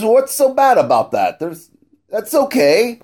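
lightly voiced wife. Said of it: "and she says".

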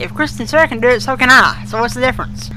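ordinary talk

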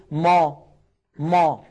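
ما darkened, pressed up to the palate; heavy meem. Shown as a demonstration. Teacher shows what Arabs avoid, heavy wrong ما. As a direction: say ما with a heavy back vowel. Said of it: "وأن أضغط هواء الميم".

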